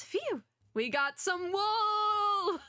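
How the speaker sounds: high, drawn-out mock cheer; triumphant. Detective Frog celebrating getting some wool, triumphant. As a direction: stretch it into a long, high whoop.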